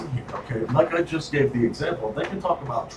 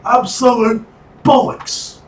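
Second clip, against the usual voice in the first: slow, loud, high British exclamation; British accent; 10 semitones higher, 6 dB louder, slower than usual. Pretend. Co-host echoing the bollocks verdict, drawn-out loud British accent. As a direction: draw it out as an outraged Brit. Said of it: "I'm gonna be doing that accent".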